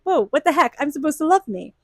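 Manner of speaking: a jolt of offended surprise at yourself; shocked inner voice. Retold or imitated. Reader voicing their own hurt reaction, mock startled.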